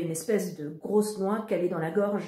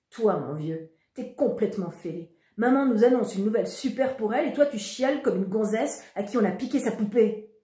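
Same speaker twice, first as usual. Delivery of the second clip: contemptuous scolding; 4 semitones higher, 6 dB louder than usual. Acted. Brother scolds crying sibling.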